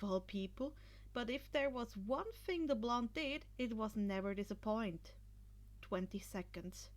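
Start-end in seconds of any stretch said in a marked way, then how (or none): none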